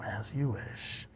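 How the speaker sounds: very soft, slow, downcast reply; sad. Retold or imitated. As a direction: let it out quietly, acting hurt. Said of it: "she just looks away sad".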